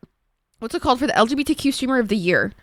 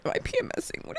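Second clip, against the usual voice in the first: whisper; 13 semitones higher, 12 dB quieter, much faster than usual. Acted. Whispered aside to chat.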